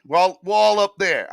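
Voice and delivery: loud, high fake New York accent; shaky attempt at New York accent; bad New York accent. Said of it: "That's probably a bad New York accent".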